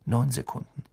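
plain reading voice

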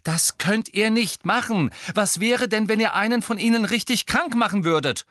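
high, loud scolding; outraged rebuke. A narrator's reading. Hermine fearing the first-years get sick, outraged. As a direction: scold them with mounting alarm.

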